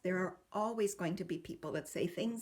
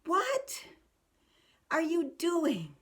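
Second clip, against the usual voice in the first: put-on much higher, slow child's puzzled question; sniffling child; 8 semitones higher, 3 dB louder, much slower than usual. Child stops sobbing, asks parent much higher and slow. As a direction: ask it as a child just done sobbing. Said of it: "stop sobbing and say".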